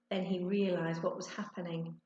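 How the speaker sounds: plain reading voice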